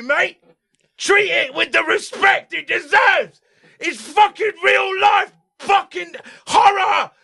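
high, drawn-out, furious shouting; Ray Winston screaming. Retold or imitated. Actor demanding respect for the scene, screamed imitation. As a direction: build from angry rant to full scream. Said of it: "screamed at by the scariest man".

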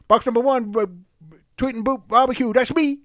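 high, loud hammy contestant voice; hammed-up country cook. Mimics a barbecue show contestant.

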